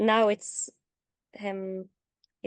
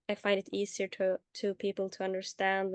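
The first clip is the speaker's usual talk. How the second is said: ordinary talk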